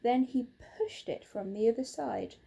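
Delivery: usual speaking voice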